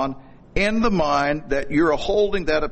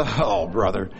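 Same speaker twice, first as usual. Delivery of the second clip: mock groan; 8 semitones lower than usual. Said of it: "Those of you who are groaning".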